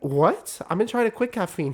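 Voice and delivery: high disbelieving cry; shocked. Retold or imitated. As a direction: sound stunned. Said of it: "I was like".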